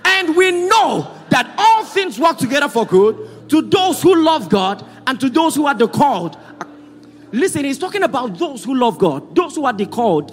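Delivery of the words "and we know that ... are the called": high, forceful scripture declaration; congregational reading aloud. Real, not acted. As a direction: lead everyone through the verse with raised voice. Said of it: "Everybody read again together".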